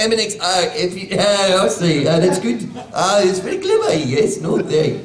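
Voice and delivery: put-on a laughing, tentative stab at a South African accent. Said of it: "make a South African ask for breakfast".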